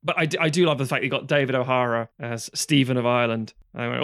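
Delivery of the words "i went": ordinary talk, nothing marked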